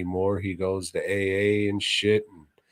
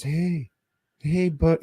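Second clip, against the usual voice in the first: wrong Reeve voice; 7 semitones higher than usual. Retold or imitated. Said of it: "he doesn't talk like that".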